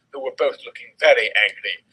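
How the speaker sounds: ordinary delivery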